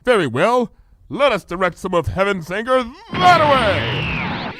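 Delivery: high regal voice, archaic speech; royal Luna voice. Acted. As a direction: speak as a grand princess. Luna realizes, decides.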